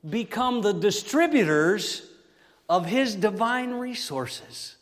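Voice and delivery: full-force preaching. Acted. Preacher driving home his point to the congregation at full force. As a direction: preach it out to the church.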